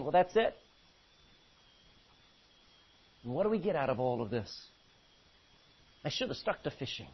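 low, quiet, quick grumbling; deflated, giving up under the breath; dismay. Acted. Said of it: "shaking his head in dismay".

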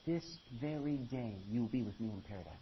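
"This very day" said low and soft; correct reading, hushed. Shown as a demonstration. Preacher gives congregation the true reading, quietly. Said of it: "Not tomorrow".

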